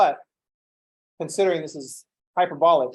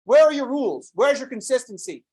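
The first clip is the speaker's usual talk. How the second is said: higher and louder, mock outrage; indignant retort; 7 semitones higher, 4 dB louder than usual. The teacher's own retort to pedants, high, loud and indignant. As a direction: throw their own rules back at them, loudly. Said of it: "I'm like".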